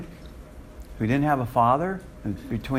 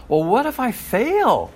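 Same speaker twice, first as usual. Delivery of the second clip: higher, slow worried plea; Moses's excuse; 9 semitones higher, slower than usual. Retold or imitated. Preacher voicing Moses's fearful excuse to God, high and slow. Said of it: "excuse in chapter four".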